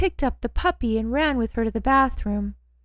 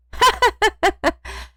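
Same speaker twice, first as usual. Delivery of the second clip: chuckle; 10 semitones higher, 5 dB louder than usual. A narrator's reading. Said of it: "Benoit chuckled from the corner".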